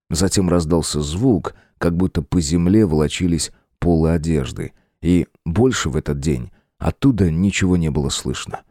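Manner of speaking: plain reading voice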